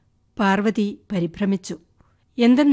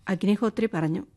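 ordinary delivery, nothing marked

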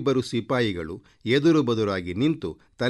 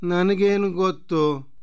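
higher, drawn-out pert reply; mildly cheeky, raised pitch; pert retort; 9 semitones higher, slower than usual. Acted. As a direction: answer back lightly, stretching it out. Girl retorts to the queen.

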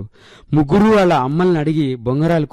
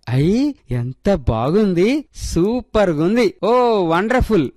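put-on slow, drawn-out gasps of childlike awe; amazed children's praise; delighted wonder; 6 semitones higher, much slower than usual. A narrator's reading. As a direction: marvel at the plant, higher and savouring. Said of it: "ముగ్గురు మూడు కాంప్లిమెంట్లు ఇచ్చారు".

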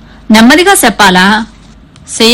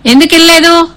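father's sharp demand; 9 semitones higher than usual. A narrator's reading. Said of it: "తండ్రి అడిగాడు".

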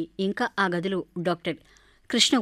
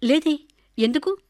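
higher, slow, jolted; startled reply; 7 semitones higher, 3 dB louder than usual. A narrator's reading. Radha, startled by the boy's question, answers slowly and higher. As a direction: caught off guard by the boy's question. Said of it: "ఉలిక్కిపడింది రాధ".

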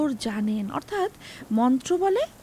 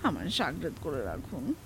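low, soft, humble plea; pleading; 11 semitones lower, 7 dB quieter than usual. A narrator's reading. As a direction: beg meekly in a lowered voice. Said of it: "Habu কাতর সুরে বলল".

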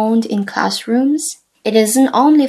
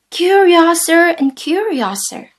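a touch of dramatic wonder, higher; lightly dramatic Alice line; 7 semitones higher, 4 dB louder than usual. Shown as a demonstration. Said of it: "Say Alice's line dramatically".

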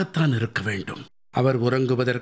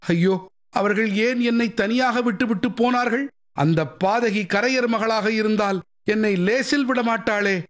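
high, frantic pleading to a ghost; terrified old king; 8 semitones higher than usual. Acted. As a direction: beg the unseen spirit in rising dread. (பேயைக் கண்டு அஞ்சிய அரசனின் பதறிய கெஞ்சல்)

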